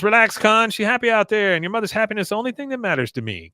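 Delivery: calm him falsely; hammed-up accented reassurance; Min's accented voice. Min voiced soothing.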